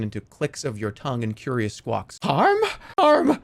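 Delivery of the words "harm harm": very high, loud questioning squawk; high loud squawking bird; screeching bird. Acted. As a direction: screech it back like a wary hawk. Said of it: "The bird screeches back".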